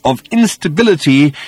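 slow, distinct, patient. A narrator's reading. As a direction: enunciate it as if to a slow listener. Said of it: "slowly and distinctly and patiently".

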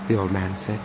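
usual speaking voice

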